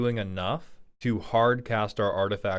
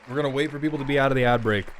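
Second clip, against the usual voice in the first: rattled off fast, louder; fast repeat; 3 semitones higher, 3 dB louder, much faster than usual. Shown as a demonstration. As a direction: hurry through it, a bit louder. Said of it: "repeated faster".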